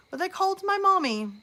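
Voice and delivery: whiny high mommy. Real, not acted. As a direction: pitch jumps way up on "my mommy". Indignant retelling, repeated line.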